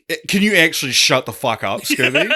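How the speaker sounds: fed-up driver telling the dog off; a hint of grumpy Shaggy. Co-host mocks rude Shaggy in car, laughing. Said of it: "and he's like".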